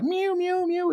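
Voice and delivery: high, tiny mock mews; soft high kitten mewing, imitated. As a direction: squeak out a little kitten's mew. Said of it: "it's all like".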